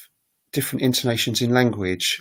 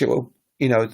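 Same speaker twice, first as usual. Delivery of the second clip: usual speaking voice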